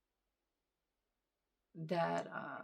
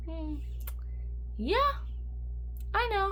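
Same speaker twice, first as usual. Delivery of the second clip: much higher, bright fake-cheery brush-off; mock upbeat; 11 semitones higher, slower than usual. Telling her doctor she's fine, in a mock upbeat voice. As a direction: pretend everything's fine, hammed up. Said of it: "And I'm like".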